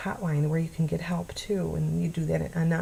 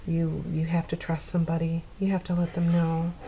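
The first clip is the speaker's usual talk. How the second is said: normal talking voice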